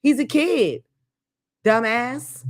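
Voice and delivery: ordinary talk, nothing marked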